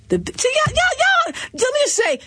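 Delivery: can't hold back the excitement; excited outburst. Real, not acted.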